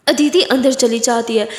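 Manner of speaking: ordinary delivery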